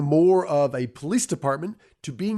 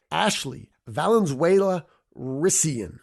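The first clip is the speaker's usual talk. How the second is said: slow full name; slower than usual. Shown as a demonstration. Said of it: "nine syllables in her name".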